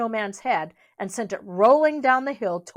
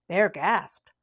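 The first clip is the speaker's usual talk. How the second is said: plain reading voice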